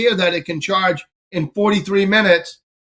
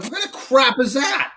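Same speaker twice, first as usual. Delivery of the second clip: higher-pitched incredulous complaint; indignant; 7 semitones higher, 4 dB quieter than usual. Retold or imitated. Driver reacting to a hotel-only charger rule, indignant and higher-pitched. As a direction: balk at a ridiculous rule.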